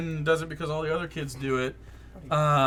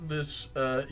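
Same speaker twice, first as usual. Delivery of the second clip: usual speaking voice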